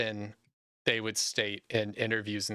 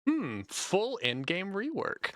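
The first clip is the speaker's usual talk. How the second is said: higher, slow, pondering hum; musing developer; 8 semitones higher, slower than usual. Retold or imitated. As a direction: play a dreamy dev musing on a big rework. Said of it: "Mark with the speech bubble being like".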